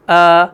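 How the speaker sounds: light, unrounded long آ; fine, fronted آ; thin alif. Shown as a demonstration. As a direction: keep آ thin and open. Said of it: "بعد والے الف کی آ".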